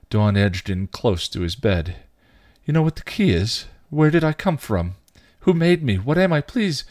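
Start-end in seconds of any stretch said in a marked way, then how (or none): 2.7-6.8 high-pitched, urgent questions; desperate pleading. A narrator's reading. Dawn edges close to his bed, pleading. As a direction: beg for answers, pitch staying high.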